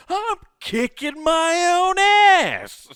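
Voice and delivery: a triumphant stretched-out holler; gleeful shout. Retold or imitated. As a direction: bellow it like a crazy kid scaring bullies. Punchline of the bully story.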